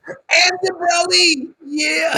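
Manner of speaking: high, loud excited squeal; excited cry. Real, not acted. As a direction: squeal it out with delight.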